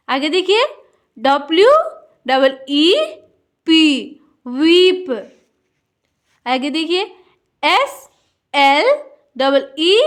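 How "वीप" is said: वीप with a drawn-out ee; long ee. Shown as a demonstration. Teacher reading a double E word, stretched वीप. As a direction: hold the vowel in वीप before the p. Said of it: "थोड़ा लंबा होगा".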